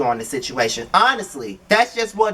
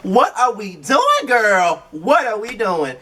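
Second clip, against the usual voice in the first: slow, higher, exasperated what are we doing; exasperated girl; 4 semitones higher, slower than usual. Retold or imitated. Vlogger reacting in disbelief to the reality show scene. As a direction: plead with a friend in disbelief. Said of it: "And I'm just like".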